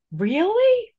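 high, disbelieving really; surprised. Retold or imitated. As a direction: pitch it way up in surprise. Friend reacts to news.

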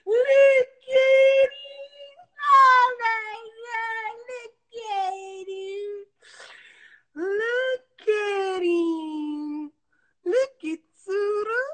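very high drawn-out squeal over a beloved pet; excited squealing. Real, not acted. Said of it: "I'm so excited about my baby".

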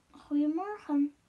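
cheerful. A narrator's reading. Hedgehog walks in to greet mouse, cheerfully. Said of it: "De egel kwam opgewekt".

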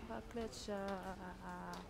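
Chocolate shop stretched out slowly; drawn-out Chocolate shop. Shown as a demonstration. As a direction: savour each syllable of Chocolate shop.

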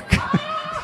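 sudden high burst of laughter. Real, not acted. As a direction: break out laughing at your own story. Laughing at the dog punchline.

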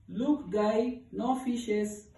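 high, sustained hippo voice; high singsong character call. A narrator's reading. Hippo shows mouth to god.

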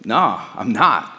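low, slow, subdued admission, holding back tears; retelling a choked-up answer to his brother; close to tears. Retold or imitated. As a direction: quietly admit you're not okay, on the edge of crying. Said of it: "I don't wanna cry".

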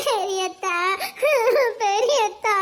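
tearful drawn-out wail; bawling between sobs; sobbing. Acted. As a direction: cry the name out brokenly. Bawling girl in wedding quarrel.

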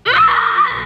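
high, loud imitated crying wail; mock wail. Teen calling mom after a ticket, mock wail. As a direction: wail like a hysterical kid, hammed-up. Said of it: "I called my mom, like".

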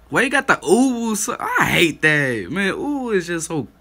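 ordinary talk

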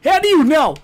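high, loud indignant yelling; outraged shout. Acted. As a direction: bellow it in disbelief. Outraged goose caught again.

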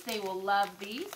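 ordinary talk, nothing marked

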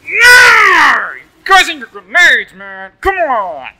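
high, very loud gamer rage; long yell into an angry complaint; frustrated shouting. Real, not acted. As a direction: yell in exasperation at the other players. Rages at players' grenades.

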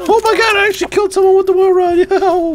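thrilled shout. Real, not acted. Gamer celebrating a kill to his squad, thrilled shout. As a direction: yell in delight at the kill.

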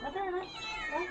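high coaxing call; coaxing a pet. Real, not acted. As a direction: coax the cat to come. Coaxing the cat closer.

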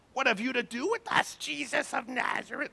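put-on voice of an unclean spirit; evil demon voice. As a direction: cry it out as a creepy demon. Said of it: "You just picture this evil voice".

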